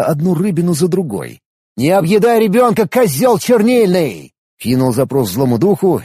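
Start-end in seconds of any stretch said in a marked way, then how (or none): 1.8-4.3 angry scolding. Acted. Scolding the ink blob.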